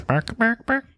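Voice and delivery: high squawky bird noises; chicken imitation. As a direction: cluck like a hen. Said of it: "I don't sound like a chicken".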